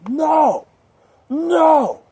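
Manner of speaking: loud, high, long-held no; emphatic refusal. Retold or imitated. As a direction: protest with a big high, drawn-out cry. Mock protest at tired friends.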